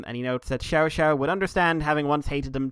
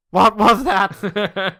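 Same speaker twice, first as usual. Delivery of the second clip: loud, slow, higher cry of disbelief; mock bewilderment; 6 semitones higher, 9 dB louder, much slower than usual. Voicing a stunned villain for a co-host, mock bewildered yell. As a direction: drag it out loudly, half laughing.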